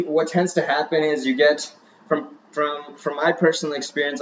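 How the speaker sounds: ordinary talk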